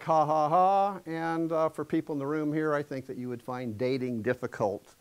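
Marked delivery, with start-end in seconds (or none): 0.0-1.0 demo laugh. Shown as a demonstration. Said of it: "clearly doesn't sound like".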